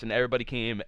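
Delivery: ordinary talk, nothing marked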